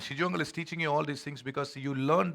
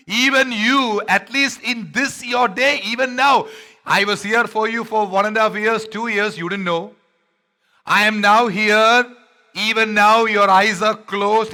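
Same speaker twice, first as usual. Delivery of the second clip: loud, high, very slow lament; Jesus crying out; 7 semitones higher, 12 dB louder, slower than usual. Acted. Jesus weeping over Jerusalem, loud drawn-out lament. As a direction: plead over the city, drawn out. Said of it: "and he's crying".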